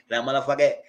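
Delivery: ordinary talk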